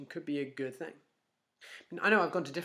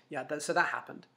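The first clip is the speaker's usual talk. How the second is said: ordinary talk, nothing marked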